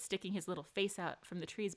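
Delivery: ordinary talk, nothing marked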